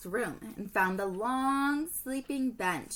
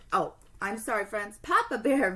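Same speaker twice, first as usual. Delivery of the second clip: ordinary delivery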